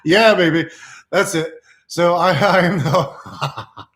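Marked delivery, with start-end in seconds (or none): none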